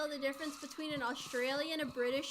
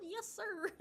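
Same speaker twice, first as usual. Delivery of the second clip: high, giggly yes-sir at normal volume; pitched-up reply between laughs; performed on request; 7 semitones higher, 4 dB quieter than usual. Pretend. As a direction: repeat it high and playful, without shouting. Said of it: "me to say it like that".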